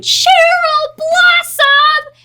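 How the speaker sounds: very high, loud, drawn-out imitated scream; fan scream. Imitating a girl screaming at her in the Grove, fan scream. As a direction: shriek each name slowly like a starstruck fan. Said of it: "a girl screamed".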